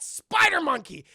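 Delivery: big, drawn-out announcement, loud and high; loud, stretched-out villain reveal; dramatic reveal. Acted. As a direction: boom the name out slowly.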